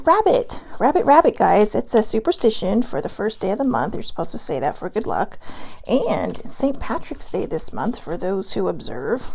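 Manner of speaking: normal talking voice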